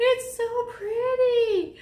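high, slow swooning admiration; real delighted gasp. Real, not acted. Gasp at pretty stitch.

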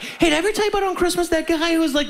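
high, fast excited bro telling a story; eager buddy. Retold or imitated. Driver telling his buddies again, a high, fast, eager bro voice. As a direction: rush it like a guy with a favourite story.

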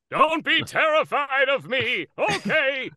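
high, slow goofy clown. Acted. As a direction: play a silly clown, drawn out. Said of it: "what would he sound like as a clown".

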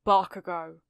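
understated grave naming; serious. A narrator's reading. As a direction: say the name with mild gravity. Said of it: "his face and voice serious".

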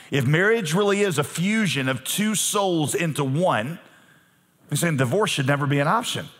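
ordinary talk, nothing marked

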